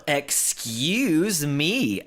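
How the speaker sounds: normal talking voice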